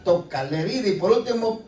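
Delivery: ordinary talk, nothing marked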